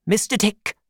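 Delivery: put-on high old aunt voice; aunt addressing Mister Dick; aunt calling. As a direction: get his attention.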